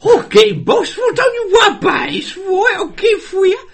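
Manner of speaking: very high, shouted henchman squawk; hammed-up eager sidekick, loud and squealing; goofy henchman. Goofy henchman promising the boss, shrill shouting. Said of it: "one of the goofy henchmen".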